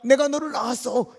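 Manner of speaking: forceful fatherly assurance, pitched high; God reassuring his child. Acted. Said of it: "하나님이 이제 우리들을 향하여 말하기는".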